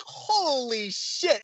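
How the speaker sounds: high, drawn-out retold astonishment; slow, stunned exclamation; awed amazement. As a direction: stretch the first word in wonder. Retelling amazement at the replay.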